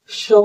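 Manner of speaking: श said as a soft sh, tongue off the palate; sh sound. Shown as a demonstration. Teacher showing the Hindi sh sound. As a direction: hiss out श with air only. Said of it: "जहाँ पे हम अपने टंग को".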